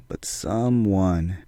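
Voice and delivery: very slow, soft reveal; hushed suspense. Acted. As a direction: drag it out in a hush.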